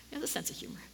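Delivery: breathy whispered aside, drawn in close; hushed confiding whisper. Real, not acted. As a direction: lean in and whisper it like a secret. Whispered aside about God's humor.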